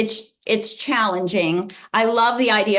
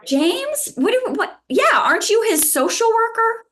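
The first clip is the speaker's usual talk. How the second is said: much higher, incredulous questions; baffled disbelief; 13 semitones higher than usual. Retold or imitated. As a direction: sound totally thrown by the question. Retelling a baffled exchange.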